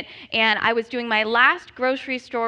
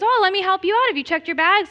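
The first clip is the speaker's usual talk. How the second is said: higher, louder customer-service voice; helpful manager; 7 semitones higher, 4 dB louder, faster than usual. Retold or imitated. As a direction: pitch it like a keen store manager. Manager offers to help.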